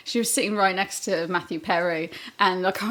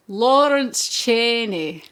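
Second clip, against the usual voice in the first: slow, louder imitation of Lawrence's accent; drawn-out mock accent; put-on accent; 5 dB louder, slower than usual. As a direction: put on an exaggerated regional accent. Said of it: "doing the accent might be offensive".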